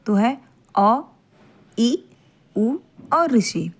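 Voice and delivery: short vowels अ, ई, उ, ऋ said crisp and brief; short vowels अ ई उ ऋ, each one crisp and brief; short vowels demo. Shown as a demonstration. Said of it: "आप खुद उच्चारण करके देखिए".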